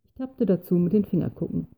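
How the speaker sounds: ordinary delivery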